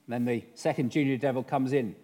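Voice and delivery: usual speaking voice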